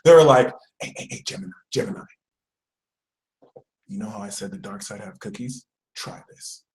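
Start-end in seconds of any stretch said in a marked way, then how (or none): 0.8-2.0 whispered, low summons; secretive psst-style whisper; furtive recruiter. Acted. Dark side secretly recruiting her. 3.9-6.6 very soft, low, near whisper; hushed conspiratorial pitch; furtive tempter. Acted. Dark side secretly offering cookie.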